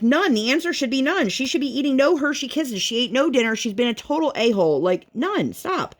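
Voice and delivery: high-pitched, indignant objection; fed-up mom laying down the law; exasperated retort. Retold or imitated. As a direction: put your foot down, retelling the argument. Arguing with relatives over child's chocolate.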